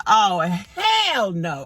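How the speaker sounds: slow, drawn-out flat refusal; hell no refusal. Retold or imitated. Owner refusing the dog in the front seat, slow drawn-out no. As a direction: stretch it out like a firm no way. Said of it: "I said".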